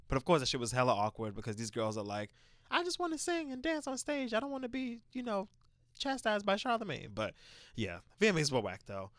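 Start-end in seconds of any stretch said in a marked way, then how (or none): 2.7-7.1 mocking girly voice. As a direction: play it as a prissy pop singer. Mocking the [name] girls.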